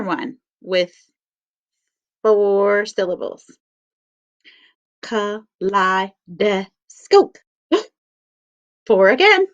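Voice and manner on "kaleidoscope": Kaleidoscope drawn out slowly, syllable by syllable; slow syllable count. Shown as a demonstration. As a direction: count Kaleidoscope out on the body, beat by beat. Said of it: "So I'll show you how".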